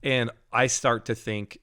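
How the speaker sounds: ordinary talk, nothing marked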